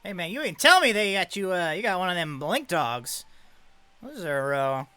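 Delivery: high, loud, eager dealer spotting a prize; brash showman. Acted. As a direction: perk up, loud and greedy. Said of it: "he's like".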